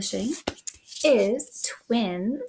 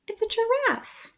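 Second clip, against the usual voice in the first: excited; 11 semitones higher than usual. Acted. Reveals the answer to kids.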